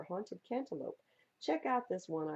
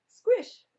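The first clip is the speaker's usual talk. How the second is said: loud squelching splat; squish sound; 16 dB louder than usual. Acted. Reading a road-trip poem, a loud bug splat.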